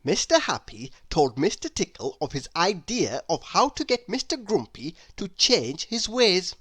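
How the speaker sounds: ordinary delivery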